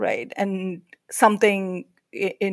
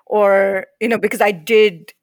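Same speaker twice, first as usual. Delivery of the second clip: ordinary delivery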